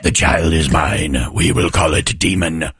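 deep, slow, menacing prophecy; ominous doom voice. Acted. Jokingly naming the baby Demon, in a deep ominous voice. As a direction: intone it like a horror movie omen.

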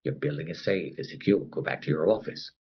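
droning loudspeaker loop; the building intercom repeating the all-clear, soft and low; intercom announcement. Retold or imitated. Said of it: "you can hear the intercom".

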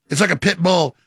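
ordinary delivery

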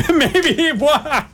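laughing, high drawn-out echo; helpless real laugh on the words. Real, not acted. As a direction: stretch it out high through laughter. Laughs while echoing the co-host.

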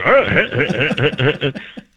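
chuckling impression laugh; long, tight, stuttering chuckle; Seth Rogen. Shown as a demonstration. Comedian finishing his impression, mimicked laugh. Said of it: "it becomes Seth Rogen".

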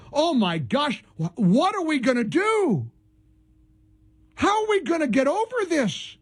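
hammed-up alarm over nothing; mock dismay. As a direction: pretend to be devastated. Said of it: "capture my sarcasm".

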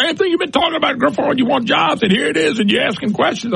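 high-pitched imitation of a challenger; imitated critic. Critic confronting the host about jobs, imitated in a high voice. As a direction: mimic someone challenging you, voice pitched well up. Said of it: "I've had somebody say".

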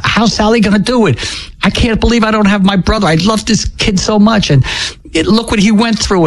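grief. Real, not acted. Grieving brother's death, retelling.

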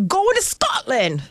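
a high, slow, attempted Scottish accent on "Going to Scotland"; poor Scottish accent. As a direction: try a Scottish accent and miss. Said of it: "Is that a Scottish accent?".

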